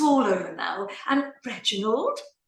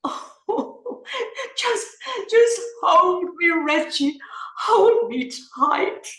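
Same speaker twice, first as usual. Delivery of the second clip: loud crying plea, higher; sobbing plea; 7 semitones higher, 4 dB louder than usual. Acted. Wife clinging to her husband, loud sobbing plea. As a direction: cry out for him to hold you.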